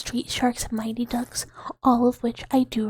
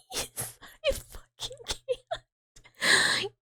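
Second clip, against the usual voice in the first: cracking up; 13 semitones higher, 5 dB quieter, much slower than usual. Real, not acted. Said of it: "breaks up laughing".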